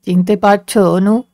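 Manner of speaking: low-pitched voice of a man from her past; low voice out of a memory; remembered man calling. A narrator's reading. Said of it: "বহুদূর থেকে যেন ডাকছে".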